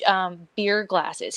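ordinary delivery

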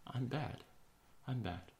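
very soft, imitated self-talk; hushed, falling echo of a child; mock whisper. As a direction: say it under your breath, as if believing it. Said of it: "whisper to themselves".

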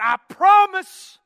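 high, loud, drawn-out mock vow; emphatic promise. Wife promises husband to be on time, emphatic and high. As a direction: swear it loudly, stretching the words.

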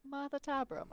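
light British accent on the name. Shown as a demonstration. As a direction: copy her British take of the name. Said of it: "in a British accent".

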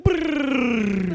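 high, loud rolled brrrr like a flurry of notes; mock piano trill. Child prodigy mimicking fast piano, a loud high brrrr. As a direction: buzz out a burst of notes.

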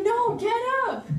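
high-pitched rallying plea; urgent encouragement. Acted. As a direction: urge a sulking child onto their feet. Voices God urging her up.

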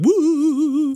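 high sharp whoop; imitating a toy's high yelp; motion sensor Santa whoop. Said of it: "we always used to imitate this Santa Claus".

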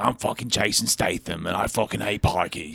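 put-on low, soft British movie tough-guy accent; Jason Statham. Host parodying a Guy Ritchie movie, British tough guy. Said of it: "it's like a Guy Ritchie movie".

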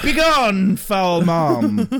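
loud, high-launched theatrical banishing; mock exorcism. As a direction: cast out a demon, hammed-up. Mock banishing of a summoned mom.

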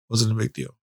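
usual speaking voice

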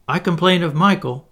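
high, loud tattling; instant complaint. A narrator's reading. Curly telling on Michael for whispering, instantly. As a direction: jump in at once to tell on him. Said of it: "said Curly instantly".

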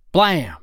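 a loud, high, sudden "Blam!" like a shot; gunshot blam. A narrator's reading. Narrator bursting out with the gunshot noise that wakes you. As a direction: bark the gunshot out sharply.

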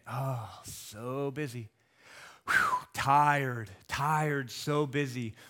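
slow, low, sighing weariness; worn-out busy person. Retold or imitated. Pastor imitating what busy people answer, slow and weary. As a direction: drag it out like you're exhausted and proud of it.

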